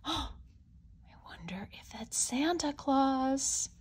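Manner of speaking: softly whispered, slow and secretive. Acted. Wondering aloud to the child about Santa, whispered. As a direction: whisper so Santa won't hear. Someone on the roof at night.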